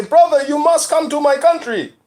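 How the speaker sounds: high-pitched welcoming driver impression; imitated Liberian driver. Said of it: "They say".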